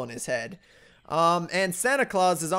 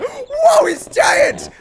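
high loud laughing astonishment; very high loud whoa; amazed shout; 9 semitones higher, 9 dB louder, much slower than usual. Real, not acted. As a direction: shout in disbelief. Character grows huge.

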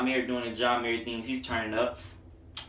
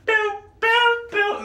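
very high sung boom boom; high falsetto beat vocals; 4 dB louder, much slower than usual. Real, not acted. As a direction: echo the hook way up top. Mimicking the beat.

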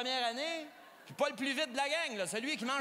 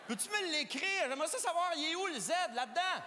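ordinary delivery

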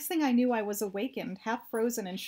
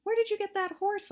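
much higher astonished shout; shouting back; 11 semitones higher than usual. A narrator's reading. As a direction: shout across the canyon. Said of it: "I shouted back".

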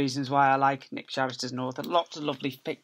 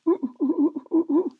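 monkey hoots; 14 semitones higher, 7 dB louder than usual. Acted. Monkey noise from the book.

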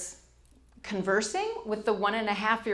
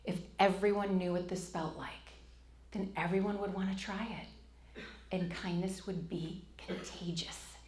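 awed inner thought; 11 dB quieter than usual. Retold or imitated. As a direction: whisper it like a realization. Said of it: "And I thought".

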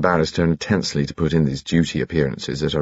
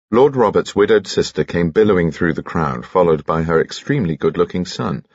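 plain reading voice

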